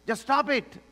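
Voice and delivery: high and punchy; firm command. Acted. Pastor voicing Paul to the church: high, firm order. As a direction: snap it out.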